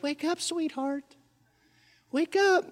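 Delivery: put-on soft, hushed wake-up to a baby; tender whisper. Parent softly waking a sleeping baby, tender hush. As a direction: coax a sleeping baby awake gently. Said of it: "like you would wake up a sleeping little baby".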